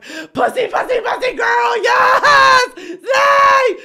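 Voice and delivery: very high, screamed cheer; ecstatic cheering. Retold or imitated. Reader cheers on woman leaving him, screaming excitedly. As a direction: squeal and shriek in mock celebration.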